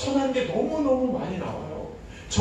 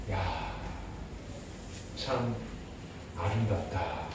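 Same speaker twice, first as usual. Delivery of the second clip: low, soft wondering praise; admiring God; 11 semitones lower, 11 dB quieter than usual. Retold or imitated. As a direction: marvel quietly at creation. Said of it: "그러셨어요". (세상을 만들고 감탄하시는 하나님의 나지막한 목소리)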